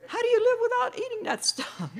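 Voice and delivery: high, loud disbelief; shocked outrage; incredulous. Retold or imitated. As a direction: newcomer aghast at the rules, high and loud. Shocked at the rules.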